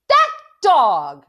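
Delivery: furious, very loud scream, each word punched. A narrator's reading. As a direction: scream it out as an enraged mother. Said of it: "Mama's screaming".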